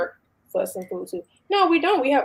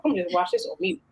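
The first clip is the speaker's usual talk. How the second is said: ordinary talk, nothing marked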